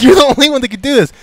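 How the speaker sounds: high, loud, delighted; excited accusation. Retold or imitated. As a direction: point the finger with glee. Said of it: "He's like".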